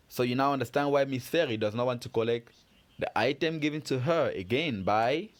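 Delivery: ordinary talk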